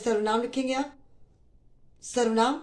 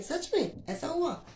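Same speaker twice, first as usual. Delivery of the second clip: high, slow astonished question; a slow, high-pitched "really, it happened?"; surprised; 7 semitones higher, 4 dB quieter, slower than usual. Shown as a demonstration. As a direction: ask it in open disbelief, drawn out. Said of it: "आश्चर्य का भाव प्रकट हो रहा है".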